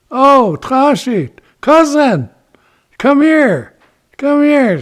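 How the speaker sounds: high, loud, drawn-out hail; calling out. Acted. Ichiko hails his cousin Turkey over, calling out. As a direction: holler to a cousin across the way.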